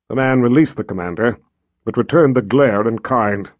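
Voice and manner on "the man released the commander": ordinary delivery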